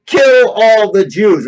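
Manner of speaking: a hint of a ranting dictator; imitating Hitler command. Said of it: "he would say".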